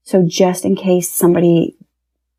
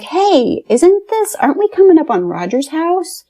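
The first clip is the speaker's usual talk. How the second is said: surprised; 10 semitones higher than usual. Retold or imitated. Spotting Roger's house from the car.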